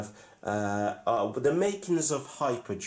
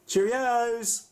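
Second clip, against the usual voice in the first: cheery sign-off; 14 semitones higher, 4 dB louder than usual. Real, not acted. Sign-off shout.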